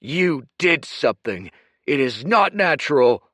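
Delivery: growled. Acted. Kuruk confronting Dorian, growling. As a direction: growl the suspicion.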